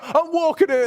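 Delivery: high, loud New Yorker outburst; hammed-up New York accent; mock New York yell. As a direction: shout it like a cabbie cut off in traffic. New York movie quote.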